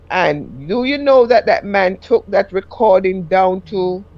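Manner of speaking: ordinary talk, nothing marked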